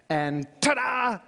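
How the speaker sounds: high, slow showman flourish; triumphant ta-da. Acted. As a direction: present it like a magic trick. Presenting the finished tower.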